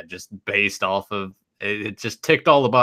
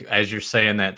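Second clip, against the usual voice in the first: ordinary delivery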